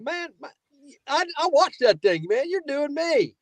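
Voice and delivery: high-pitched impression of a comic buddy; Henry Cho. Buddy teasingly confronts his imitator, high mimicking voice. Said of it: "He was like".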